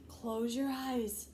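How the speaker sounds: slow soothing voice. Acted. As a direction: hush them gently, slowly. Frederick soothing the mice.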